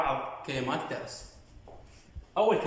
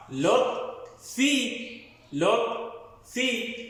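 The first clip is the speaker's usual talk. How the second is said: لط then a high في; syllable drill; 3 semitones higher, much slower than usual. Shown as a demonstration. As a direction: split لطفي into لط and a rising في. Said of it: "متحرك مع ساكن مقطع". (تقطيع لطفي: لط، في)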